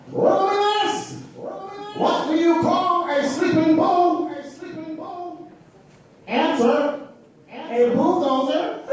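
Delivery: very high, slow sing-song jokester; corny Riddler joke. Retold or imitated. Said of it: "the little corny joke they used to do".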